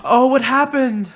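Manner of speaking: big gasp, then high, loud question; mock shocked concern. Eager listener to a fellow victim, mock shocked concern. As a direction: overplay the scandalised curiosity.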